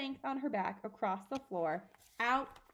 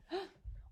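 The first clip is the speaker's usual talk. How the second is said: quick high startled gasp; sharp gasp; 9 semitones higher, 7 dB quieter than usual. Acted. Reader gasps as the chalk writes, startled. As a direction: gasp in shock.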